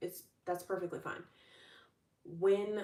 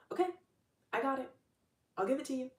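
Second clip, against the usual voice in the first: higher, easy fatherly yes; agreeable father; 7 semitones higher, much faster than usual. Retold or imitated. Father granting the child's list, lightly voiced and higher. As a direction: say yes like a doting dad. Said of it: "And the father's like".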